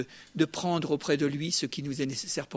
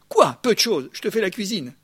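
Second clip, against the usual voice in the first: loud, higher burst of mock outrage; indignant retort; 3 semitones higher, 7 dB louder than usual. Martha answers Jesus back about her cooking, indignant. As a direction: fire back as the offended cook.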